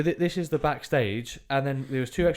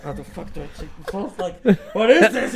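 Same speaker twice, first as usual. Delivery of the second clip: laughing bewilderment; 6 semitones higher, 5 dB louder than usual. Real, not acted. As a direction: laugh through the confusion. Laughing at own confusion.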